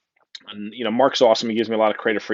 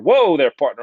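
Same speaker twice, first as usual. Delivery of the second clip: loud cowboy whoa, higher at first; playful, louder cowboy exclamation; mock cowboy interruption; 4 semitones higher, 7 dB louder than usual. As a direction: cut yourself off with a big whoa.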